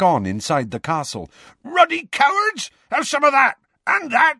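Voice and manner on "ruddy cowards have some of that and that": high, loud fighting roar; furious bellow. A narrator's reading. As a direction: roar as he swings.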